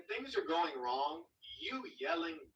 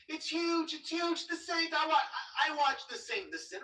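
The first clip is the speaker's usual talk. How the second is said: lightly voiced Trump, higher; Trump impression; 11 semitones higher than usual. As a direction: ham up Trump saying it's huge. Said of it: "Just like Trump says".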